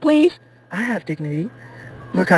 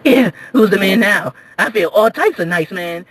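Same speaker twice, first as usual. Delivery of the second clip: usual speaking voice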